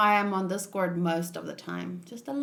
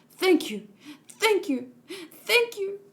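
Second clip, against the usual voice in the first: each thank you higher and more eager; building thanks; 9 semitones higher than usual. Acted. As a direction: climb up in pitch with growing gratitude. Grateful character repeats thanks.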